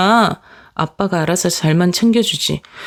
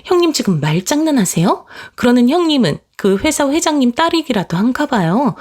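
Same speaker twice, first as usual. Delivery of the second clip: a touch higher, quick retort as the sister-in-law; snapping sister-in-law; 5 semitones higher than usual. Acted. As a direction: fire back at her sister-in-law, lightly voiced. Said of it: "자기도 따다다 쏟아내더라고요". (살짝 높게 쏘아붙이는 동서)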